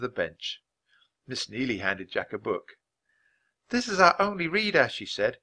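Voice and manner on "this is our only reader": higher-pitched, kindly teacher voice; encouraging schoolteacher. Acted. Teacher opening the first lesson, encouragingly. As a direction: invite the pupil to begin.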